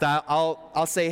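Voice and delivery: ordinary delivery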